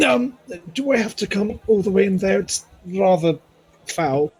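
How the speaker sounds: high-pitched, squeamish complaint; reluctant fussy visitor. Acted. Visitor asking not to enter the smog, high and reluctant. Player voices his reluctant character.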